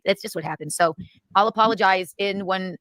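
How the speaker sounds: usual speaking voice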